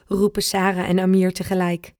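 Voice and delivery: ordinary delivery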